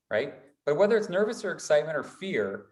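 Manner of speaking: ordinary talk, nothing marked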